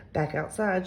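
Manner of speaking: plain reading voice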